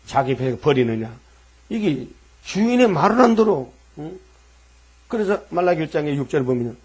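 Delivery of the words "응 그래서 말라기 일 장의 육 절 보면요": normal talking voice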